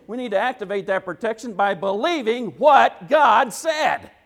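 rally the church at full voice; preaching shout. Real, not acted. Preacher urging the congregation to activate God's protection, shouting.

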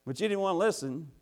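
high, loud mocking call; Wisdom taunting. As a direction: jeer like wisdom laughing at a fool. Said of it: "Wisdom is laughing, saying".

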